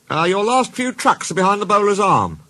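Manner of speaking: high-pitched fielder calling over; high storybook cricketer hail. A narrator's reading. Fielders call to the train.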